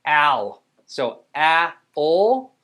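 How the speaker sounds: "Al", then "a" and "ol" apart; loud stretched "a", pause, rising "ol"; sounded out. Shown as a demonstration. Teacher helps with a tricky sound, sounded out. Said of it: "we're gonna help 'em out".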